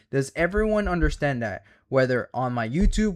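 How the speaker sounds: ordinary talk, nothing marked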